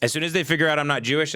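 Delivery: rushed. Shown as a demonstration. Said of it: "it turns from this".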